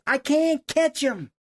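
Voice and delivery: high, slow retold holler; calling up. Hunter looking up at guide, calling out. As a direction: imitate a drawn-out call from below.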